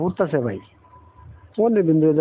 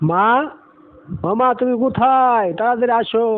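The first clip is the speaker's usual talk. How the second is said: calling out for mother; 7 semitones higher than usual. Acted. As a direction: shout for mom to hurry over. Child calling mother from afar.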